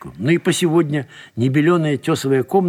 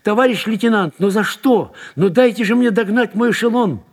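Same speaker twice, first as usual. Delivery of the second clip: high, raised-voice plea; desperate pleading; 9 semitones higher, 4 dB louder than usual. A narrator's reading. Detained man begs to catch his train, desperately pleading. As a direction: plead urgently to be let go.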